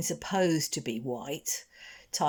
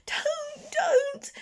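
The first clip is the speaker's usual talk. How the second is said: very high, tearful plea; feeble moaning. Acted. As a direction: whimper at the stranger's hand. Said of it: "the Snargit moaned feebly".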